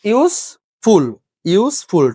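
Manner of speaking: "ইউজফুল" chopped into ইউজ and ফুল; split into syllables. Shown as a demonstration. Teacher showing the class two syllables, "ইউজফুল" in separate beats. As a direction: punch out each half of "ইউজফুল" separately. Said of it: "two syllable, তাহলে কি".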